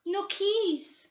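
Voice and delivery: put-on high old woman with a Portuguese accent; Portuguese-accented old woman refusing flatly; Brazilian housekeeper. Old woman stonewalling the detectives in a Portuguese accent.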